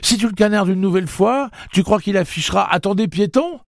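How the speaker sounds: high, loud, worked-up rant; excited high-pitched outcry; exclaiming. A narrator's reading. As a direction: protest loudly at the top of your range. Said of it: "s'écria Eddie".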